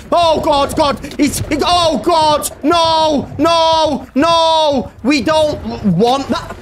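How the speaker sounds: high, frantic shouted nos; screaming in terror; panicked high-pitched shouting; real panic. Real, not acted. Monster attacks again.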